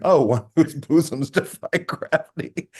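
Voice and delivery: a cheeky line broken by laughter; laughing quote. Retold or imitated. Teasing a woman named Hillary, laughing. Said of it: "without saying".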